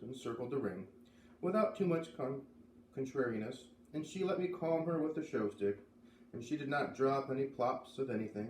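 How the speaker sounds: plain reading voice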